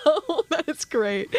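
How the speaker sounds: slow words through laughter; laughing it out. Real, not acted. Host laughing at the guest's deli story, slow laughing words.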